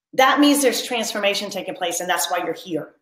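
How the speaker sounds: ordinary talk, nothing marked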